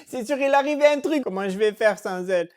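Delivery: ordinary talk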